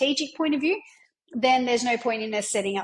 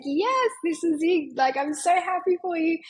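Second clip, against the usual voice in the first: happy cheer; 6 semitones higher than usual. Retold or imitated. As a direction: cheer her client on, beaming. Coach cheering her client's win.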